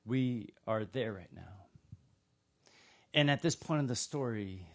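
ordinary delivery

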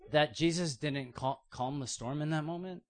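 normal talking voice

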